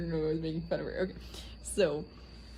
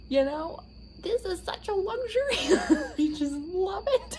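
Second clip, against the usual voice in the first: high, louder dreamy mom voice; imitating mom; 13 semitones higher, 4 dB louder than usual. Imitating mom savoring the car wash luxury, high dreamy. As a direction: savor it like a treat. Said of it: "she's like".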